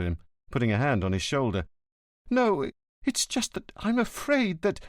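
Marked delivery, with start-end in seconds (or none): 2.3-4.8 higher, breaking off in fear; lightly voiced scared son; frightened son. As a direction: stammer out the fear and stop.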